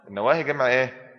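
ordinary delivery

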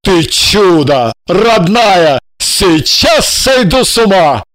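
лёгкий восторженный возглас, медленно; ликовать, чуть не крича; восторженно. Acted. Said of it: "воскликнул он". (Жарон узнаёт, что станет отцом)

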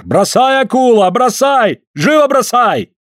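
high, loud shouting; shouting over wind. Acted. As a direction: yell it over the gale. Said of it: "орёт сквозь ветер".

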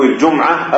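الجمعة as "al-jum'a", no vowel after m; silent mim. Shown as a demonstration. Teacher giving the correct forms, الجمعة with sukun on mim. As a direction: close the mim in الجمعة, jum-'a. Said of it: "ويجوز فيه إسكان الميم".

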